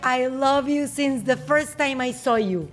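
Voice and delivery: English line dropped into Spanish; spanglish. Real, not acted. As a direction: switch to English mid-chat. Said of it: "¿En inglés?". (frase en inglés dentro del español)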